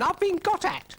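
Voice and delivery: very high, loud shriek. Acted. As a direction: shriek his own name. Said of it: "shrieked Brian".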